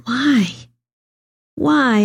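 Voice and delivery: a touch of retold lament; anguished cry. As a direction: cry out in grief, half retold. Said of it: "I asked over and over again".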